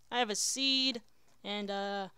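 ordinary delivery

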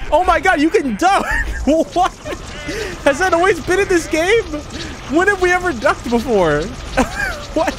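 high-pitched laughing disbelief; astonished discovery. Real, not acted. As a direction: squeal in amazement at your own discovery. Discovers that ducking is possible.

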